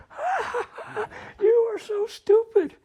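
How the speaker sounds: high-pitched jeer with fake laugh; mocking sister. Sister behind gullible brother, high mocking laughter. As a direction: taunt him in a high kid voice. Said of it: "there's Elma behind going".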